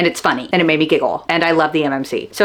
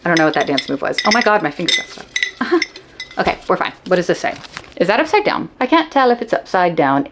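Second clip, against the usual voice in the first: usual speaking voice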